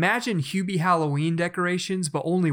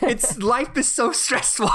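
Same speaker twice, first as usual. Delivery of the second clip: mock despair; 8 semitones higher, slower than usual. Mock lament about parenting.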